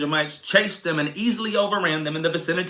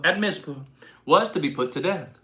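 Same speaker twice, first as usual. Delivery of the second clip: usual speaking voice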